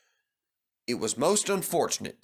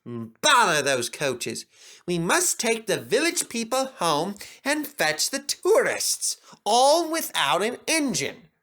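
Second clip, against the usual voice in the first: exasperated; 7 semitones higher than usual. Acted. Manager annoyed at coaches.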